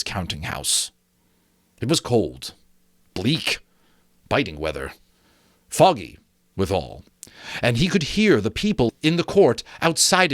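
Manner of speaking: measured storytelling read; story narration. A narrator's reading. Reader narrating Dickens to listeners, storytelling voice. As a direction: tell it like a fireside tale. Reads the novel aloud.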